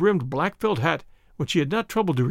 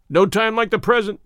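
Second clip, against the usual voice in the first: eager, louder, higher retort; crying out; 4 semitones higher, 5 dB louder than usual. A narrator's reading. Boarder daring his rival to fight now, crying out. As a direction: call the challenge out at once. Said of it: "cried McMurdo".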